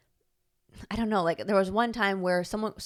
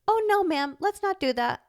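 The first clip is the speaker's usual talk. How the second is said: put-on high child's echo of a teacher's polite scolding; polite correction, imitated; 12 semitones higher than usual. Girl copying her teacher's words to another girl, polite. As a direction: pitch it way up, politely firm. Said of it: "that's the polite way".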